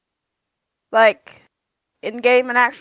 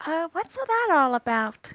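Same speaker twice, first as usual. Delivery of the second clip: hushed, higher-pitched aside; confidential question; 6 semitones higher, 9 dB quieter than usual. Acted. Aria leans down to ask Torin privately, hushed and curious. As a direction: ask softly, leaning in close. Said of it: "lean down to Torin and ask in Druidic".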